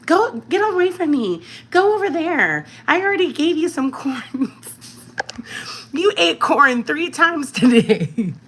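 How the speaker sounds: put-on high, loud shooing of a pestering child; high-pitched mock mom scolding a kid begging for corn; mock scolding parent. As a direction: shoo the kid off, exasperated and high. Shooing a kid away mid-live.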